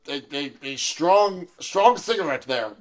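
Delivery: ordinary talk, nothing marked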